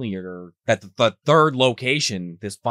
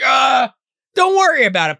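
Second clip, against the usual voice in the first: high, louder put-on voice; mock nervous dismissal; 13 semitones higher, 7 dB louder than usual. Candidate waving off his manager, high and loud. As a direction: brush it off nervously.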